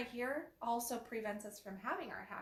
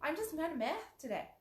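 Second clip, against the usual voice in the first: owning meh; 7 semitones higher, faster than usual. Shown as a demonstration. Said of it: "let's own that we're meh".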